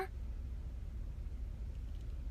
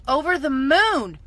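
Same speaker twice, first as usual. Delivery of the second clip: "Over the moon" repeated louder and higher, "moon" stretched; emphatic repeat; 4 semitones higher, 6 dB louder than usual. Real, not acted. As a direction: burst out with it the second time. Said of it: "I was over the moon".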